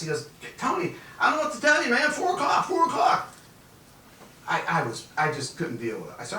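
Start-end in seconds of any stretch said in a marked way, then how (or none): 0.6-3.3 mock stubborn clerk. Ken refuses, mocking the deadline.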